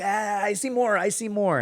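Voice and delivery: high-pitched mock-eager cop voice; greedy cop. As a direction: imitate a cop pouncing on extra cash. Cop spots money in wallet.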